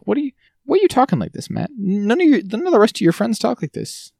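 high, fast exasperated rant; mock exasperation. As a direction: take Matt to task in comic disbelief. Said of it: "where I'm just like".

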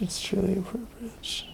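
soft breathy whisper. Real, not acted. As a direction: breathe the words out. Whispered aside.

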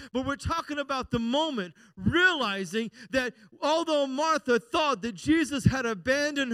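loud, high, emphatic sermon delivery; impassioned preaching. Real, not acted. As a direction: proclaim it to the whole congregation. Preaches at full force.

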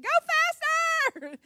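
put-on high, loud, stretched-out kid yell; girl on the back seat screaming at dad to speed up; child's gleeful scream. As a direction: drag it out like a thrilled little girl. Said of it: "seat screaming".

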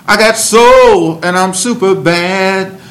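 high, loud funky soul shout. Retold or imitated. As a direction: belt it like a soul singer. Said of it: "think about the Rolling Stones and James Brown".

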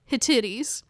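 "Hittites" as hih-TIT-eez; alternative pronunciation. Real, not acted. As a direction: say "Hittites" in three syllables ending in -eez. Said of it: "that word right there".

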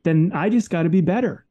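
a driven "just gotta be better" attitude; determined attitude. Retold or imitated. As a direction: refuse to lose, push yourself on. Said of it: "that attitude of like".

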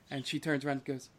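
ordinary delivery

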